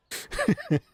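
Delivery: very high burst of laughter; real high-pitched giggle fit; squeaky laugh. Real, not acted. Laughing at acid joke.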